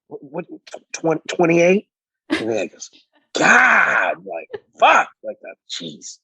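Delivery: retold incredulous sputtering; mock disbelief. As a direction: stumble over it like a stunned boss, then exclaim. Imitates Jeff's disbelief at age.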